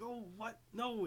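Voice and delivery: high, startled yelp of disbelief; shocked at price. Retold or imitated. Rentaro seeing the outfit's price tag, high shocked yelp. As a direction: jump up in pitch at the shock.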